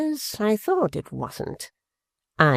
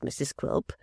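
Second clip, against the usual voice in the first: whispered name, much softer; hissed aside; 9 dB quieter than usual. A narrator's reading. Quilp ordering his wife, whispered. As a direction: drop to a whisper.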